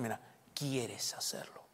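slow, soft whisper; confiding whisper to the congregation. Real, not acted. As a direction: lean in and whisper it. Aside while preaching.

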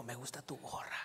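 very soft, hushed imitation; whispered. Older woman complimenting his hat, whispering. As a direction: retell it under your breath. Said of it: "pero susurrando así".

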